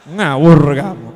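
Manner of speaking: mock scolding. Preacher mock-scolding a foolish listener, slow and stern. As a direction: tell them off slowly.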